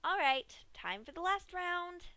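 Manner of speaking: high, cheerful pony voice; Pinkie's voice. Acted. Pinkie tells Dash the last round starts, high cheery voice. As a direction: announce it like a game.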